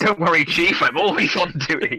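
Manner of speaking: hammed-up eager cartoon inspector; Inspector Gadget. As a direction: reassure the boss like a bumbling cartoon cop. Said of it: "turned into Inspector Gadget".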